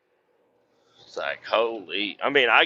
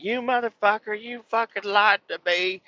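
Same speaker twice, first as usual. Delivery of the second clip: high-pitched mock tirade, only slightly raised; mock screaming; 12 semitones higher than usual. Scale operator cussing out her coworker, high mock scream. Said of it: "She's, like, screaming at him".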